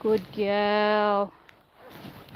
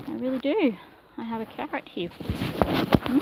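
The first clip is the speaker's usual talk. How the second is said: usual speaking voice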